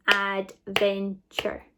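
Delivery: ADVENTURE slowly split as ad, ven, ture; clapping syllables. Shown as a demonstration. Teacher clapping out syllables, ADVENTURE in three slow beats. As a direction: give ADVENTURE three separate, drawn-out beats. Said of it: "let's clap out the syllables".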